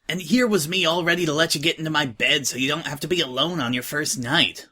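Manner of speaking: ordinary delivery, nothing marked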